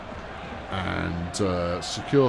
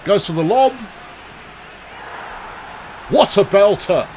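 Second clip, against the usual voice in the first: excited commentary; 8 semitones higher, 4 dB louder than usual. Real, not acted. Reacting to a lobbed goal.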